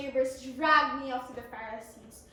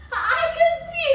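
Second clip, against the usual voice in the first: shouting with joy; excited exclaim; 11 semitones higher, 13 dB louder than usual. Acted. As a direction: burst out in joy, much higher. Joyful climax of healing.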